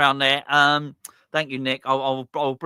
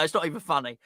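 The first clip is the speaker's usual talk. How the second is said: usual speaking voice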